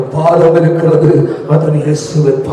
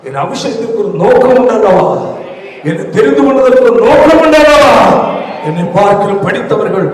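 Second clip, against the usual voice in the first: pleading prayer building to a shout; 5 semitones higher, 5 dB louder than usual. Acted. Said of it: "தேவனிடத்தில் கேள்".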